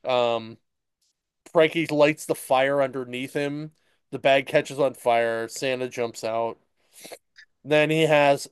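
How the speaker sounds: ordinary talk, nothing marked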